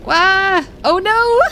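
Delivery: high startled yelp, then a laughing cry. Real, not acted. Streamer yelps as Monkey falls down the stairs. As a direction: shriek as the character tumbles.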